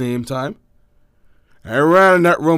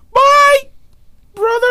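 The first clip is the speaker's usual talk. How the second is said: much higher, louder sing-song; mocking little sister; 6 dB louder, slower than usual. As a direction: mimic the sister's chirpy goodbye. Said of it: "she was like".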